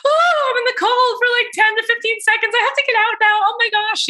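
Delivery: high silly mock panic; silly scared younger self. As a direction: squeak it out like a spooked kid in the cold. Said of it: "all silly sort of".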